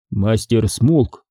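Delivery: ordinary delivery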